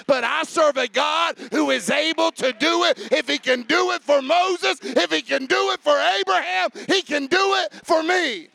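rising, loud preacher's cadence that builds; full-force preaching. Acted.

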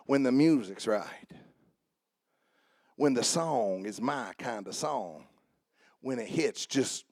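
low, soft, dreamy mock swaying to the beat; mock picky worshiper. Pastor imitating worshipers who need the right song, soft mock humming. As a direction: hum along like a lazy worshiper, lightly mocking.

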